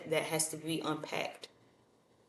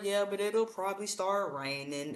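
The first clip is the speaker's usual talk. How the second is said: slow, droopy mock Eeyore; imitating Eeyore predicting rain; mopey Eeyore; 3 semitones higher, slower than usual. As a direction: drag it out pessimistically. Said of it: "Eeyore syndrome".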